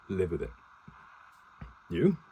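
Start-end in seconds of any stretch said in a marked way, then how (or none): 1.9-2.2 incredulous question shooting up high; disbelief. A narrator's reading. Officer eyes Harper as a colonel, disbelieving. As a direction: question it with a sharp upward leap. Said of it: "stared at Harper with disbelief".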